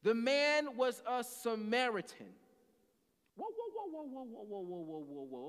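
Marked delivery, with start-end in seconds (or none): 3.4-5.5 tumbling whoas. Real, not acted. Halts himself after reading verse.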